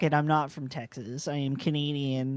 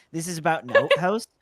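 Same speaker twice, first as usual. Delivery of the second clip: Canadian accent, "outhouse" said as "oot-hoose"; the Canadian "oot" take set against "about an oot"; 4 dB louder than usual. Shown as a demonstration. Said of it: "you can hear my oots coming out".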